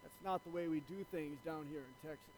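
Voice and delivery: low, quiet Texan farmer; lightly acted old farmer, voice dropped. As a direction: set a stranger straight, low and soft. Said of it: "this Texan farmer, he goes".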